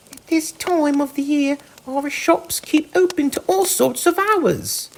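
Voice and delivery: high, small mouse voice, politely deferent; respectful field mouse. A narrator's reading. Field mouse answering the Rat, respectful and high. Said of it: "replied the field mouse respectfully".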